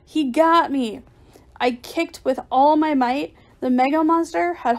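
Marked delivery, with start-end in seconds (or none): 0.0-1.0 loud, panicked cry; screamed alarm. A narrator's reading. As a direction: yell that you've been caught. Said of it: "I screamed".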